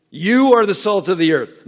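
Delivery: loud, high, YOU punched at the start; full-force sermon proclamation; proclaimed. Acted. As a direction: declare it to the whole room.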